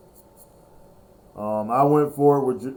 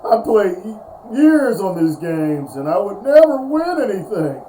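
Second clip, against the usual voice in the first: excited; 8 semitones higher, 7 dB louder than usual. Real, not acted. Excited recap after the win.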